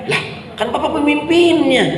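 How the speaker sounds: high-pitched baffled protest; acted indignant follower; incredulous protest.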